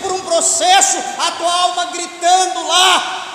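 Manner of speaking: urgent full-force preaching; impassioned warning. Real, not acted. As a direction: build to a forceful cry of warning. Preaching anguish of hell at full force.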